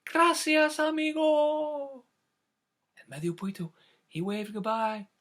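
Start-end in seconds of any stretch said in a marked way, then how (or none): 0.0-2.0 put-on high, drawn-out grateful call for kids to copy; high slow water voice demo. Shown as a demonstration. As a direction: model the line slowly, high. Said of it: "Can you try that?".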